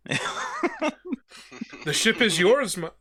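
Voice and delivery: commanding ghost captain calling for recruits; captain addressing the defeated crew; Davy Jones. Acted. GM as Davy Jones, corrected accent.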